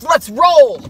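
loud barked call. Real, not acted. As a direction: bark it out. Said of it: "I just barked".

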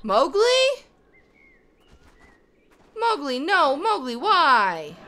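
put-on loud, pleading call after a child; dismayed calling out. Mother calling after straying cub Mowgli, loud dismay. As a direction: cry out the name in mock despair.

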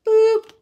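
very high, loud popping boop; playful squeaky boop; cartoon boop. Shown as a demonstration. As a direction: pop the sound like a vanishing trick. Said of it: "now you see it, now you don't".

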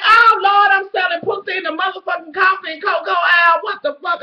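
high and loud, scandalized; mock outraged yell. Realizing she's taken for a hooker, outraged mock yell. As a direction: shout it like you can't believe it. Her own shocked reaction retold.

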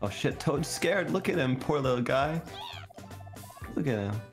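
usual speaking voice

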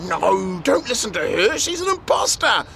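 high, accusing witch. A narrator's reading. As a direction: point the finger, a sly witch.

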